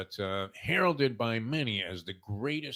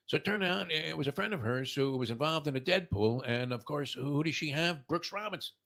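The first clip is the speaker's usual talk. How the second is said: ordinary talk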